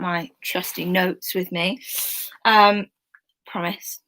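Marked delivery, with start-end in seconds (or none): 3.5-4.0 soft breathy aside; hushed reassurance; whispered promise. Real, not acted.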